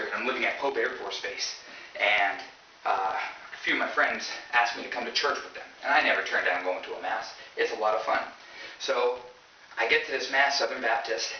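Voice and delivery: ordinary talk, nothing marked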